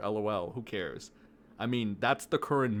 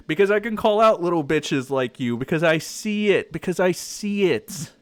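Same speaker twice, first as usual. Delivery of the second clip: loud, higher boastful rant; 5 semitones higher, 7 dB louder than usual. Real, not acted. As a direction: declare it forcefully.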